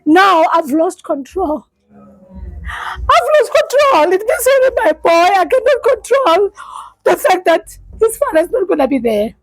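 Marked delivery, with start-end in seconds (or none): 1.9-4.1 sobbed much higher and very slow; the words crack as she breaks down; breaking into tears, high and slow. Real, not acted. Breaking down while telling story. 4.1-9.3 crying. Real, not acted. Crying about son losing father.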